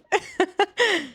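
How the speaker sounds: a high, real burst of laughter; quick high giggle. Real, not acted. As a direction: laugh out at your own joke. Laughs at own joke.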